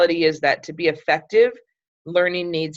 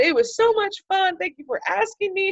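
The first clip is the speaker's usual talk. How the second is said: friend's thanks; 14 semitones higher than usual. Retold or imitated.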